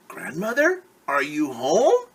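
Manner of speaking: put-on a trusting little girl thinking aloud. Red calling.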